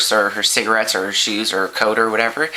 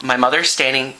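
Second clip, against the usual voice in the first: ordinary delivery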